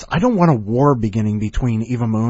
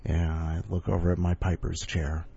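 ordinary talk

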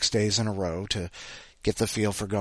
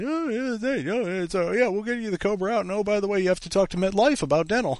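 much higher, airy put-on clerk voice; mocking the provider; 10 semitones higher than usual. Mocking the medical provider's brush-off, high clerk voice. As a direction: parody a breezy clerk. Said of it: "They said".

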